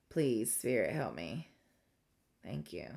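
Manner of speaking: very low, soft private plea; hushed prayer. Real, not acted. Asking Spirit for help before an emotional story, hushed prayer. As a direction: drop right down into a quiet prayer. Quick prayer to stay composed.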